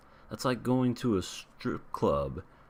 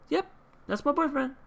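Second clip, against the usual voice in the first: very high-pitched mock girlish affirmation; put-on girlfriend claim; 4 dB louder than usual. Rental girlfriend cuts off his confession to grandparents, high put-on claim. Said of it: "she goes ahead and says".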